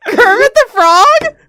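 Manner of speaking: yelling at a co-host's Kermit voice, laughing; laughing disbelief. Real, not acted. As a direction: shout through real laughter in disbelief.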